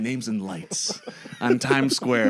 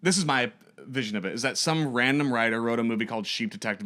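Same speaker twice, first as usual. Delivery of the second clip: ordinary talk, nothing marked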